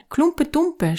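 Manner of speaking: put-on high childish call; children shouting. A narrator's reading. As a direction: squeal your pick above the others. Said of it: "schrien andere".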